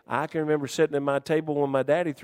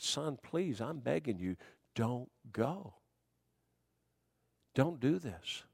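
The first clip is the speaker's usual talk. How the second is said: tearful pleading; 8 dB quieter, slower than usual. Retold or imitated. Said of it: "a tear came up my eyes".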